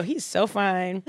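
slow, savoured admiration; slow swooning. Real, not acted. Host repeats the crush to co-host, slowly admiring. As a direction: draw it out, laughter settled.

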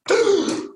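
sharp acted intake of breath; sudden mock-horrified gasp; shocked gasp. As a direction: gasp as if stunned by the news. Acting out shock at news.

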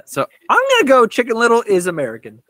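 fast, loud, cocky quiz answer; confident declaration. Real, not acted. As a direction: declare it like a winning bet.

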